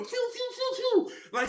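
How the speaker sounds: repeated high whooshing sound effect; imitated steering noise. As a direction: mimic a swerving wheel with high whooshes.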